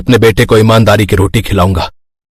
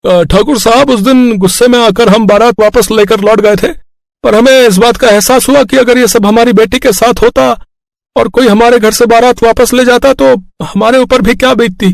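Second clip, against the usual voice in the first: much higher put-on voice of an apologetic elderly in-law; groom's father's voice; 13 semitones higher than usual. Father-in-law apologising to Thakur, high put-on voice.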